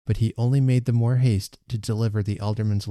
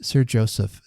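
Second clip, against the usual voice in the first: usual speaking voice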